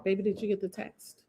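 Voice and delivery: hushed, turned away from the mic; a quick low-volume question off to the side; quiet aside. Real, not acted. Speaking softly to someone in the room, away from the stream.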